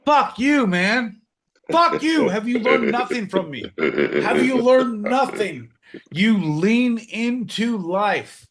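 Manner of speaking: high, mock-outraged scolding, slowing for emphasis; exasperated mentor. Mentor scolding John for missed chances, high mock exasperation. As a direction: berate a pupil who learned nothing. Berates John for not acting in life.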